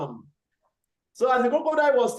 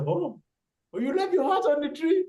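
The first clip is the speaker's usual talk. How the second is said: surprised crocodile; 7 semitones higher, 3 dB quieter than usual. Acted.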